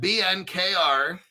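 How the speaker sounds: normal talking voice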